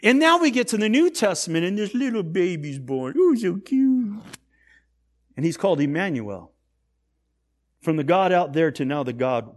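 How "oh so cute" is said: high, drawn-out coo; cooing over a baby. Acted. Onlooker admires the newborn baby, high slow cooing. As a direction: gush slowly as if over a newborn.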